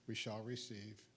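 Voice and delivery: ordinary talk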